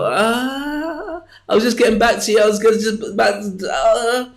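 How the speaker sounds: high, caught-out stammer; flustered excuse. Retold or imitated. Caught out by a colleague, flustered high stammer. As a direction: fumble for an excuse.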